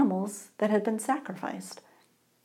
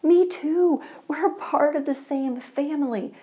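hammed-up joy as arms fling wide; joyful yell; 6 semitones higher than usual. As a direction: yell it with delight. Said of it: "he yelled with joy".

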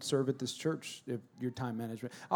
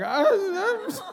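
high, wailing imitation of a crying child; mock crying; 6 dB louder than usual. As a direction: blubber like an upset little boy. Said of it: "just started crying".

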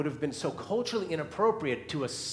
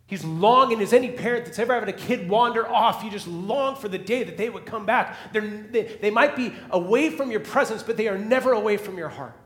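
loud, higher, urgent preaching; preaching at full force; 7 semitones higher, 8 dB louder than usual. Acted. Preacher pleading with the congregation, full force preaching. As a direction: lift the room with the father's longing.

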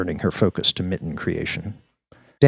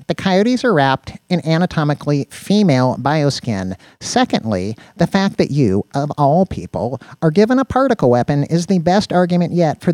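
higher, louder pedantic rant; exasperated Danny; 9 semitones higher, 7 dB louder than usual. Acted. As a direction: lecture the oaf point by point, indignantly.

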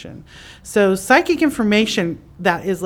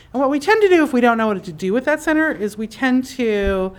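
ordinary delivery

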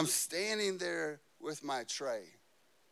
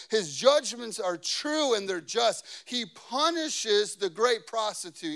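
raised, ringing preacher's reading; forceful scripture reading; 4 semitones higher, 5 dB louder, slower than usual. Real, not acted. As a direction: proclaim the verse with emphasis.

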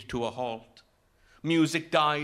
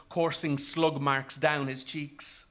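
ordinary delivery

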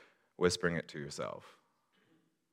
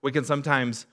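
rising, louder preacher build, slowing on the list; preaching; 5 semitones higher, 5 dB louder than usual. Acted. Pastor preaching to the congregation, building. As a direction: lift it and press each line home.

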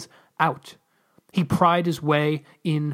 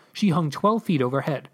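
ordinary delivery, nothing marked

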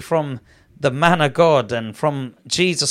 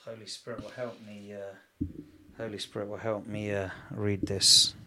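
quiet, fast murmured prayer under the breath; hushed fast prayer; 5 semitones lower, 14 dB quieter than usual. Real, not acted. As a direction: pray softly to yourself. Quiet prayer before reading.

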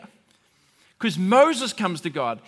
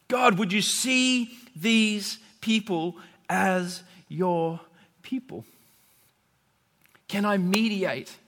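slow, drawn-out plea to God; a slow, heartfelt mediator's appeal; earnest pleading; slower than usual. Retold or imitated. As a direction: beg gently, stretching each word. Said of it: "he's pleading".

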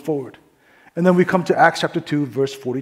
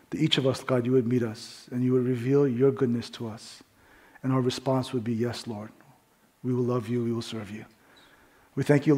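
softer, lower prayerful voice; 3 semitones lower, 7 dB quieter than usual. Acted. Pastor praying over the congregation before the sermon, soft prayer. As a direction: pray quietly over the congregation.

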